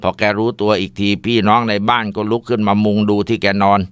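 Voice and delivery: ordinary delivery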